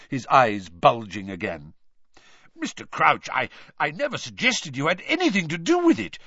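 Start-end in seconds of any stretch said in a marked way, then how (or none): 2.6-6.2 higher, stammering protest; flustered Amos Diggory. A narrator's reading. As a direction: backpedal hastily, stumbling at the start. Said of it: "muttered Amos Diggory".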